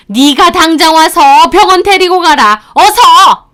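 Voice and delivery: high, loud ordering shout; demanding mother-in-law. Retold or imitated. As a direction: bark the order to come now. (병원에 데려가라고 다그치는 시어머니, 높고 큰 호통)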